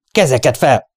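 high, loud order; shouted command. A narrator's reading. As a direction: call it out as an urgent command. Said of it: "kiáltotta".

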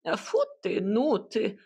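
huffy little retort; offended hut. A narrator's reading. Hut answers the grumbling cat, in an offended huff. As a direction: toss it back, mildly hurt. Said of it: "обиженно ответила избушка".

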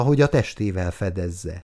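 plain reading voice

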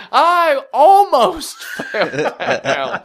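high, loud, stretched-out wail breaking into laughter; mock crying. Mimicking her backing down on her excuse, mock wailing. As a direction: imitate a child bawling an excuse. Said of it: "starts crying".

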